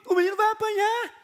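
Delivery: put-on high, whiny child's voice near tears; crying little boy. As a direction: imitate a scared little boy about to cry. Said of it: "o Johnny começa a chorar". (filho pequeno chorando com o filme, voz aguda e chorosa)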